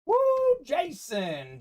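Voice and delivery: mock "woo" going up high, then falling on the name; imitated cheer. Reading a friend's cheer for Jason, imitated whoop. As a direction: imitate a fan cheering on his boy. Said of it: "cheering on his boy, saying".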